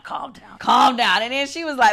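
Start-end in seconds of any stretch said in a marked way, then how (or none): 0.0-0.6 soft self-calming. Retold or imitated. Telling herself to stay cool, she says "calm down" softly. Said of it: "I need to calm down". 0.6-1.2 "calm down" pushed out louder; almost shout it at yourself; urgent self-command. Retold or imitated. Losing the fight with her temper, she says "calm down" louder. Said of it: "I need to calm down".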